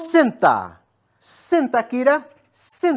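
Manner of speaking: loud, forceful dog command; strong command. Real, not acted. Trainer commanding the dog firmly to sit. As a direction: bark the command out so the dog obeys. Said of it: "por entonação mais forte".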